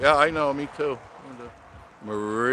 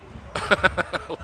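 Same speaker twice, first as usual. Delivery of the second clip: short, higher-pitched laugh; real laugh; 8 semitones higher than usual. Real, not acted. Amused by Larry's message, short high laugh. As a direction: burst into a brief laugh.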